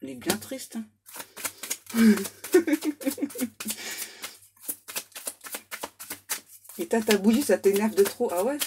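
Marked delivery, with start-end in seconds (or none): 1.1-4.3 drawn-out laugh rising into giggles; long laughing fit. Real, not acted. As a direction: crack up for a few seconds. Laughing over the cards.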